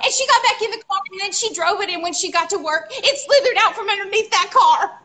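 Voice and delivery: high-pitched, worked-up telling; animated and appalled; excited storytelling. Real, not acted. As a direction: build to the horrifying reveal. Excited climax of snake story.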